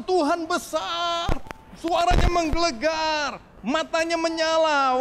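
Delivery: high, loud and slow, dramatic; thundering God description. Retold or imitated. Preacher imagining a scary answer for his child, slow and dramatic. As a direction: boom it out like a fearsome vision. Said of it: "Kalau saya bilang".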